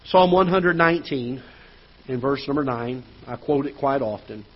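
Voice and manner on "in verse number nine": usual speaking voice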